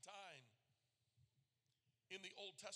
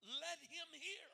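Jesus quoted; 6 semitones higher, 4 dB louder, slower than usual. Retold or imitated. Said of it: "He says".

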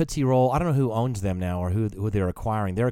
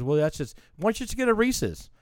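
wife's line, much higher pitch; imitating the wife; 10 semitones higher than usual. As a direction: lightly mimic her helpful suggestion, voice pitched up. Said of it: "She goes".

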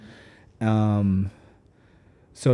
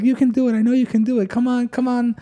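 a trolling gym buddy yelling encouragement, pitched up high; mock cheering coach; 11 semitones higher than usual. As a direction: cheer her on in a high teasing voice. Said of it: "trolling me".

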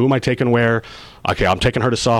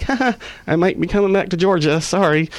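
much higher, nervous little laugh; sheepish apology; 10 semitones higher than usual. Retold or imitated. Husband phoning his wife about going back to Georgia, sheepish. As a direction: break it to her with an awkward chuckle.

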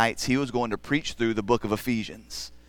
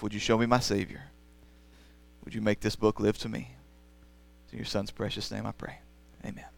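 prayer; 6 dB quieter than usual. Acted. Pastor praying before the sermon, hushed and earnest. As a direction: pray quietly for the book to come alive.

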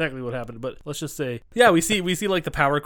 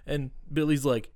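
ordinary delivery

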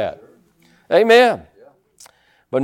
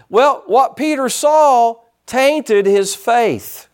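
slow, deliberate preaching; slow stress on tainted; slow emphasis; 4 semitones higher, much slower than usual. Real, not acted. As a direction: weigh every word.

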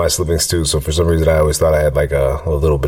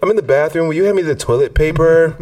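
from another room; 11 semitones higher than usual. Retold or imitated. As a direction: call out from the bathroom, laughing. Kid calling out from bathroom.

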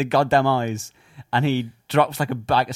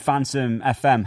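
normal talking voice

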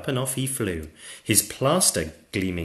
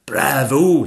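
loud, higher bravo; praising dragon teacher; 6 semitones higher, 10 dB louder than usual. Acted. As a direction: applaud your pupils aloud.

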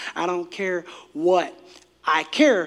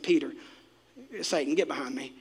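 quiet, lower, mildly fed-up rebuke; annoyed; 4 semitones lower, 8 dB quieter than usual. Acted. Jesus rebuking Peter, quietly annoyed. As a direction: rebuke him softly, without yelling. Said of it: "He was annoyed".

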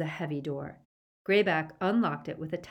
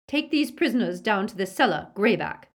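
loud, pitched-up order; curt command; 4 semitones higher, 7 dB louder than usual. A narrator's reading. Lady sends werewolf off with prisoners, loud commanding tone. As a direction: order a servant with authority.

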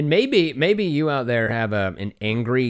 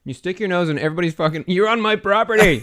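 mock outraged yell; 7 semitones higher, 4 dB louder than usual. Re-enacting the rhubarb quarrel.

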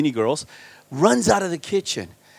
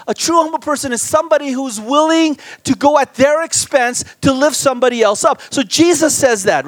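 higher, louder preaching build; full-force preaching; 8 semitones higher, 5 dB louder than usual. Acted. Preacher to congregation, on humility, preaching at full force. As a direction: drive the point home like a preacher at full force.

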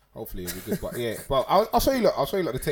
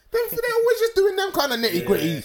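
laughing; 6 dB louder than usual. Real, not acted. Laughing while complaining about actors.

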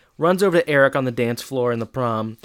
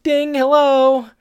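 high, louder, slow ding into a greeting; bell ding then hello; 13 semitones higher, 7 dB louder than usual. Retold or imitated. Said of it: "You know, it's like".

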